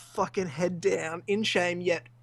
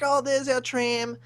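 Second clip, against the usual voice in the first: mimicked woman; 7 semitones higher than usual. Mimics the woman at tram stop.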